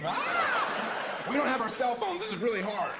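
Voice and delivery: high, whining reality-show contestant; shrieked, mock-panicked whine about no phones; whiny contestant. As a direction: complain like a spoiled American contestant. Mocking whiny Survivor contestant.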